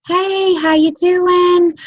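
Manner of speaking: high, slow, sing-song hello; overly sweet chirpy hello to a stranger; bubbly greeting. Shown as a demonstration. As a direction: greet the cashier like an old friend. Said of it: "you go to the grocery store".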